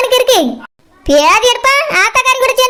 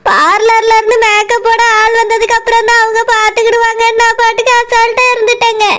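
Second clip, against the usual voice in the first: ordinary talk, nothing marked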